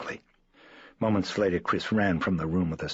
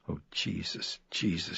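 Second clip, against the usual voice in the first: hoarse; 5 dB quieter than usual. Acted. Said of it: "she said hoarsely".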